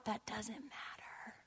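slow, unvoiced whisper; intense whisper. Angry teacher to front-row student, whispering. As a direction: drop into a drawn-out, dismissive whisper.

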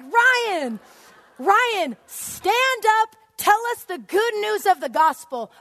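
very high, loud command; crazed yelling. Acted. Teacher demanding Ryan share the gospel, yelling. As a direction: shout orders at one cornered student. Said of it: "the point that I got crazy in the class".

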